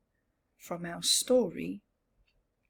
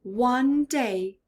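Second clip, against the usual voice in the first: usual speaking voice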